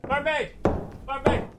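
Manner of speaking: high mock call across the tavern. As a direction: holler for the barmaid. Calling out for barmaid.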